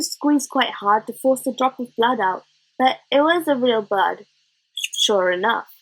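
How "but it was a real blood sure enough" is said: ordinary delivery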